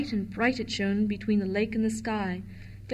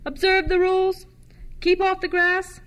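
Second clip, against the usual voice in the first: much higher, loud official bellow; the keeper bawling the park rules across the lawn; park keeper shouting; 8 semitones higher, 4 dB louder than usual. A narrator's reading. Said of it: "he shouted".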